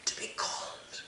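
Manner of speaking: ashamed whisper. Acted. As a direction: let the words die away in shame. Son's plea trails off.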